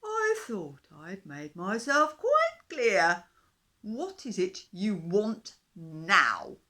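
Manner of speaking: loud, very slow, booming dinosaur; grumpy dinosaur. A narrator's reading. Dinosaur sneers at the fish king, loud and slow. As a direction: drag it out like a scornful giant beast.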